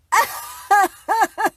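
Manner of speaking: loud high burst of laughter; real high-pitched laugh; big laugh. Real, not acted. Reading a funny comment.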